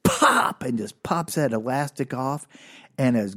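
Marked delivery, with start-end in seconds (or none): 0.0-0.6 sudden high-pitched pop sound; imitated pop. Dad snaps the elastic off, imitated high pop. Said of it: "and he goes".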